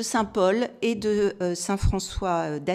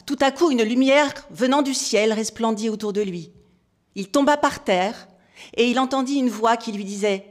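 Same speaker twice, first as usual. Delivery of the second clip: louder, projected recitation; solemn scripture reading; 4 semitones higher, 8 dB louder than usual. Real, not acted. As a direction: read the sacred text out to the whole hall. Reads scripture passage aloud. (lecture solennelle et projetée d'un texte sacré)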